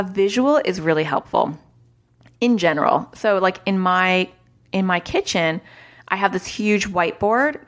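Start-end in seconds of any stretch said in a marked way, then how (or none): none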